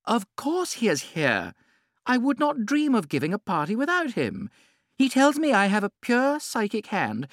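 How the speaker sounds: high, loud, gushing lady; gushing society hostess. A narrator's reading. Lady Windermere boasting to the Duchess, high and gushing. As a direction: show off her palmist with delight.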